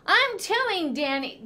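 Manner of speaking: put-on high, loud child's wail; little brother crying out while pinned. A narrator's reading. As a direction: cry out to tell on him. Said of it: "Danny cried".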